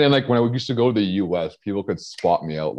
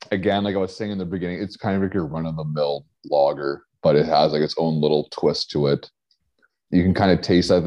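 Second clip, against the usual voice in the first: ordinary delivery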